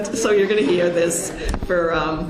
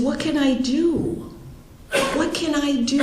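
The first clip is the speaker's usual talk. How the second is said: desperate plea; 9 semitones higher than usual. Retold or imitated. Sister desperate to help.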